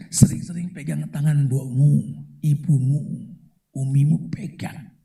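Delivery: a tender whispered plea; whispered urge. Acted. Preacher tells young men to hold their mothers' hands, whispering.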